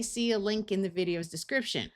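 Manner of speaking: normal talking voice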